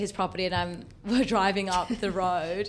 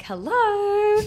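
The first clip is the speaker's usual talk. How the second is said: high, loud sing-song hello; calling hello; 7 dB louder than usual. Retold or imitated. Calling out on arrival at the ranch. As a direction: call it out across the yard.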